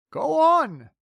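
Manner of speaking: high, loud frantic urge; frantic cry. Acted. Crew urging James, loud frantic cry. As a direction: push him desperately. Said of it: "they cried frantically".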